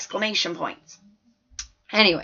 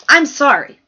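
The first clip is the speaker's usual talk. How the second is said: sharp teacher; 7 semitones higher, 11 dB louder than usual. A narrator's reading. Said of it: "Missus Smith said".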